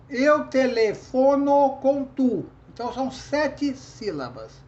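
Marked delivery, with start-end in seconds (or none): 0.1-2.5 slow, loud, measured beats stopping hard on "tu"; counting poetic syllables. Shown as a demonstration. Teacher counting up to the strongest syllable, syllable by syllable. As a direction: scan "eu telefono com tu" beat by beat, cut at "tu". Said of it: "a sílaba mais forte".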